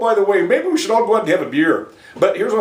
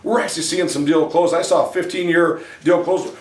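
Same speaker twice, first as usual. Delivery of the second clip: ordinary talk, nothing marked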